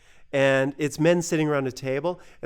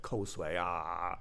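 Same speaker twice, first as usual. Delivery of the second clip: much softer, half-asleep mumble; sleepy mumble; 8 semitones lower, 12 dB quieter, slower than usual. Retold or imitated. Brother talking in his sleep, very soft. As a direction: murmur from deep sleep.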